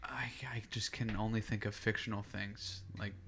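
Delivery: hushed sheepish whisper. Real, not acted. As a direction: mumble it under a laugh. Sheepish laughing near-whisper.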